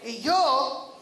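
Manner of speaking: loud, slow rousing declaration; rally the congregation; preaching shout. Acted. Preaching climax. (proclamación a gritos)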